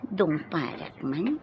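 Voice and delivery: ordinary delivery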